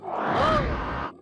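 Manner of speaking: high, stretched-out gasp of surprise; a long shocked oh, way up high; startled oh. Real, not acted. Sudden shock in game.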